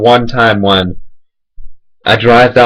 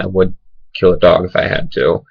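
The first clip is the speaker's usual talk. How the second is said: ordinary delivery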